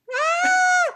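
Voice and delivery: high shocked shriek from mom; mom's horrified shriek; mock shocked shriek. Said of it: "Y mi mamá así".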